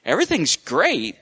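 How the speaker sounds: high, quick, laughing brush-off; breezy nervous laugh over the stammer. Retold or imitated. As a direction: laugh it off nervously, pitch jumping up. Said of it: "And I'm like".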